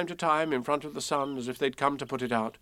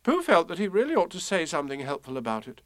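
plain reading voice

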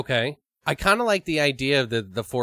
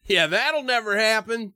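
ordinary delivery

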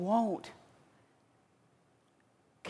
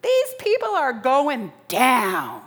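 much higher, louder gloating cheer; gleeful adversary; 12 dB louder than usual. Acted. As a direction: crow like a fan sure of victory. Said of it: "He's full on excited".

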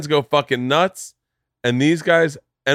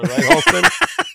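mock proud; 5 dB louder, much slower than usual. Real, not acted. Teasing appeal.

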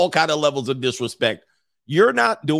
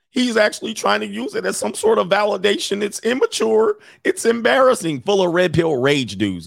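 higher whiny mock voice of a scold; mock commenter voice; 8 semitones higher than usual. Mocking the virgin commenter in his chat. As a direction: sneer it like a preachy commenter.

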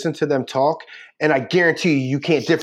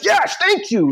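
much higher, eager cry of approval; enthusiastic agreement; slower than usual. Retold or imitated. Cheering on the Philly woman, excited yes. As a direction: cheer her on like a fan. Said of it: "And I'm like".

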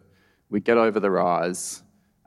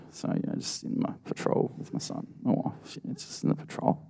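embarrassed whisper; 2 semitones higher, 10 dB quieter than usual. Retold or imitated. Dad muttering to himself.

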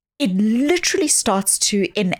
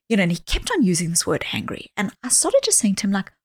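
ordinary talk

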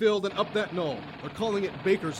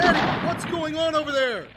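higher, louder call over gunfire; calling across; 7 semitones higher, 4 dB louder than usual. Acted. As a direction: shout to find out what happened.

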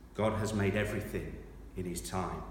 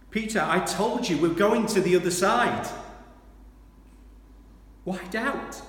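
earnest Jesus voice; 12 semitones higher, 9 dB louder than usual. Acted. Jesus voiced reassuring Peter.

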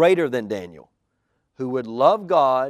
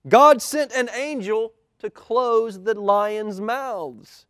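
triumphant call; 7 semitones higher, 5 dB louder, slower than usual. Acted. Daniel calls up to king.

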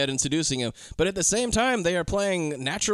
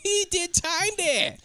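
high, slow put-on voice; mock high voice; 13 semitones higher, slower than usual. Friend continuing a Punchy joke, high and slow. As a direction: drawl it out in a silly high voice.

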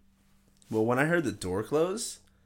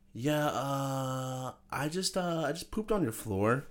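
halting, higher-pitched imitation of a tweaker; imitating tweaker; 4 semitones higher, 4 dB quieter, slower than usual. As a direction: lightly play a tweaker's hesitant confession. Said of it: "like, tweaking".

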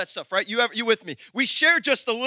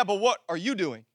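normal talking voice

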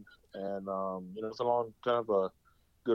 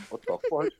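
high-pitched mock shocked outburst; shocked reaction; 5 dB louder than usual. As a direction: squeal it like a stunned friend. Friends' reaction to growl music.

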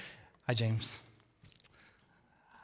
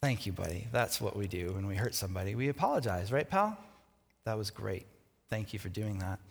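soft, lower gentle dad; tender praise; 6 semitones lower, 5 dB quieter than usual. Retold or imitated. Father softly praising his son after apology. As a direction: reassure the child kindly. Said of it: "dad praising son".

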